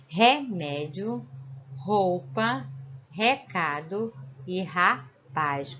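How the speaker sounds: Remédio, roupa, recado e rapaz, slow, with a guttural initial R; strong initial R. Shown as a demonstration. Teacher reading words aloud to show the strong R at the start. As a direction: read each word slowly, leaning on the first R. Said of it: "ele fica forte".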